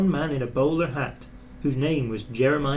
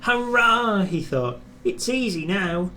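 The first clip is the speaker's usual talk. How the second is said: joyful cheer; 7 semitones higher than usual. A narrator's reading. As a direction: cheer as if you've won. Reaching the hilltop.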